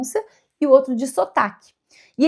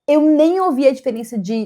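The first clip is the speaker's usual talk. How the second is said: ordinary talk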